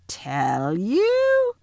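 outraged witch shrieking upward; shrill, incredulous witch; suddenly shrill. A narrator's reading. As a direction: swoop up high in shrill disbelief. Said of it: "her voice growing suddenly shriller".

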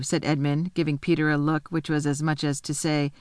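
usual speaking voice

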